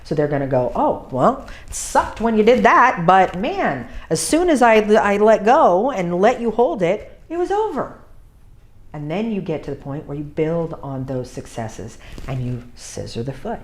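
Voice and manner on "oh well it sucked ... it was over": high, louder puppy musing; high lively puppy voice. Pretend. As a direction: voice a puppy figuring it out. Voicing puppy's realization.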